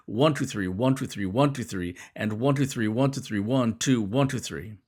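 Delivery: "One, two, three" in quick, even triplets; rhythmic count. Shown as a demonstration. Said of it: "The rhythm is pretty amazing too".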